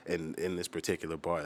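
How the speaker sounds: normal talking voice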